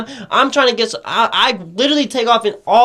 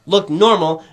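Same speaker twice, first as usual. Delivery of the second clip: ordinary talk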